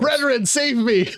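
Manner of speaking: mock plea. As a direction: plead in jest, slow and high. Joking plea for rescue.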